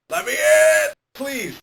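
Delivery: shrieking high and loud to be let in; frantic high-pitched plea; desperate shriek. Acted. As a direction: scream it in panic, way up high. Begging to be let in.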